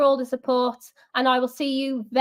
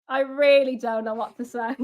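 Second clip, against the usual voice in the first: usual speaking voice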